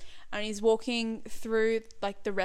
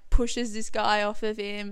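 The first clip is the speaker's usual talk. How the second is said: ordinary talk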